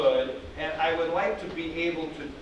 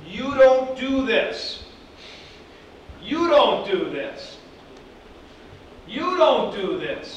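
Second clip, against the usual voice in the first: louder, higher, slow don't; teacher forbidding firmly; stress on don't; 6 semitones higher, 6 dB louder than usual. Shown as a demonstration. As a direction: insist hard on don't. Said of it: "teacher insisting, repeated".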